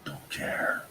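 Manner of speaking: hushed whisper, slow and soft; worried whisper. Acted. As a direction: shush everyone in a whisper. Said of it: "Why are we whispering?".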